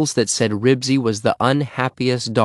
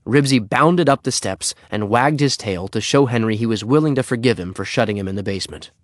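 ordinary delivery

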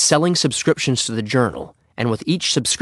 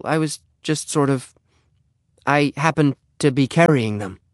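higher, halting, apologetic voice; embarrassed Henry; 5 semitones higher than usual. Acted. Henry stalls before the neighbor, flustered and halting. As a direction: stumble through the excuse, pitched up.

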